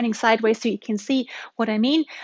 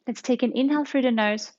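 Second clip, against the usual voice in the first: guided meditation. Acted. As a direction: ease them into relaxation. Leads a guided relaxation.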